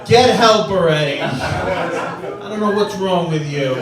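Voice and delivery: hammed-up yell at a confused father; mock shout at dad. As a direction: yell it like he's the problem. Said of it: "I go".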